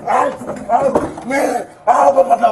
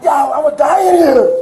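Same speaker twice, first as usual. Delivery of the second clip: frantic cry of fear; panicked shout; 2 semitones higher, 6 dB louder than usual. Real, not acted. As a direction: yell it out in real panic. Panicking man forced into car.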